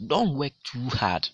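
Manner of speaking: teacher demonstrating the intensifier too; stress on too. Shown as a demonstration. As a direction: drag out too hard for the class. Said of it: "the too over there".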